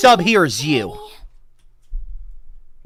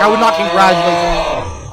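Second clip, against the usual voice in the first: sarcastic; 3 dB louder than usual. Real, not acted. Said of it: "I'm being sarcastic".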